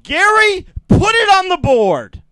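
high, loud call to the board; shouting across the room. Real, not acted. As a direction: yell it out to Gary. Shouting to producer Gary.

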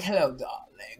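low, drawn-out diva greeting; imitating Vivica's low hello. Imitating Vivica greeting Caroline with a low "darling". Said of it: "You know, Vivica's like this".

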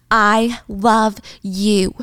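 very slow, deliberate parting words; slow love declaration. Retold or imitated. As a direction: declare it word by word, drawn right out.

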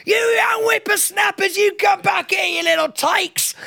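very high, hammed-up old man shouting; grumpy old man. Old man coming out at kids egging him, high hammed-up shout. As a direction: holler like a cartoon old codger, slowing at the end. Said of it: "be like".

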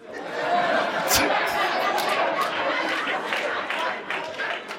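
a burst of high, breathless laughter. Real, not acted. Laughing hard at his own story to the host. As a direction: crack up at the punchline.

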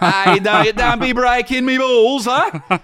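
high, loud, drawn-out mock New York accent with Australian vowels; Anthony LaPaglia. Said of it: "I'm from New York".

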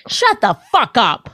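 mock the star telling the pianist off; mock furious celebrity. Host acting a celebrity snapping at the pianist, mock anger. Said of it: "And it's like".

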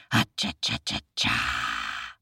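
very low, drawn-out cha-cha noise; tiger gloat. A narrator's reading. Tiger bares his teeth at the boy, low gloating noise. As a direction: stutter the sound, then stretch the last one. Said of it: "said the tiger".

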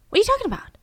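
mock bewilderment, pitched well up; confused. Mother puzzled by a condolence call, confused. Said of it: "She was so confused".

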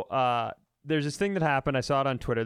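ordinary talk

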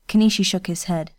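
usual speaking voice

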